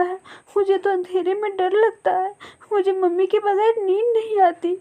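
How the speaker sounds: a frightened orphan begging for answers; the little bird weeps for her lost mother; crying little girl. Acted. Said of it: "वो बहुत रोने लगी".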